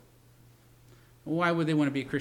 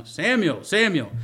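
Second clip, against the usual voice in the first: calling Samuel again, a bit higher; 5 semitones higher, 4 dB louder, slower than usual. Pretend. Preacher voicing God calling the boy twice, mildly raised. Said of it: "How did it sound?".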